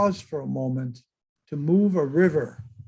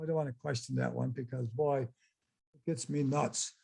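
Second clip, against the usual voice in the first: ordinary talk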